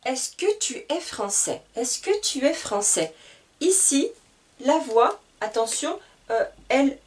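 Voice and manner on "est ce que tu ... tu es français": Est-ce que tu es français? ending flat and falling like a statement; falling tune on français; no rise at the end. Shown as a demonstration. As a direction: say it like an affirmation, no lift on français. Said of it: "elle ne monte pas".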